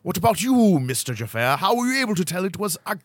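hammed-up British detective, swooping in pitch; British accent. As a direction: quiz him in theatrical British. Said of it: "Most Britishy answer wins".

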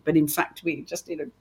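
normal talking voice

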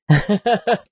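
bright laugh. Real, not acted. Laughs at own emphasis.

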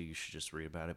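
ordinary talk, nothing marked

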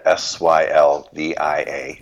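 letter by letter, slowly; spelled out slowly. Shown as a demonstration. Giving his mother's name to the host, spelled slowly. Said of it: "My mother's name".

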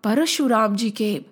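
ordinary delivery

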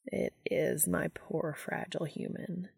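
gently teasing angel. Acted. Castiel teasing exhausted Dean, soft and fond. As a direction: coo it like comforting a child.

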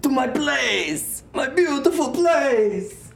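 high, drawn-out distraught wail; hammed-up outcry in a muddled accent; screaming. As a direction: cry out slowly and loudly over a ruined place. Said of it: "he's screaming".

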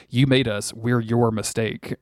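normal talking voice

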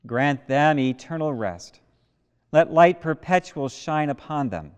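loud, ringing prayer of commendation; solemn prayer. Acted. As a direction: intone it to the whole church.

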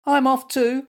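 high, loud little squeak; squeaky. A narrator's reading. Little Mutt leaving to hunt treasure, squeaking. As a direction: pipe it up high like a tiny toy dog. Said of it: "squeaked Little Mutt".

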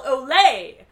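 big loud cheer; passengers' yell. A narrator's reading. Spanish passengers as the boat moves, joyful yell. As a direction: yell it out in celebration. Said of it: "the Spanish passengers yell".

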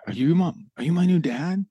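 put-on a small boy asking with hope; little boy voice. Host voicing a hopeful little boy meeting a man.

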